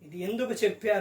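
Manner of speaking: low, soft pondering question; thinking to oneself. Acted. Preacher acting a believer weighing someone's words, quiet pondering. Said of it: "నువ్వు ఆలోచిస్తూ".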